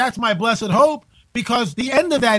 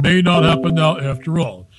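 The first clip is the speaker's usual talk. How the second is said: ordinary talk, nothing marked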